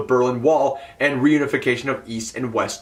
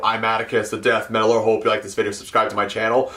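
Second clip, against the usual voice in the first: usual outro voice; 6 semitones lower, much faster than usual. Retold or imitated. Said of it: "my usual outro like".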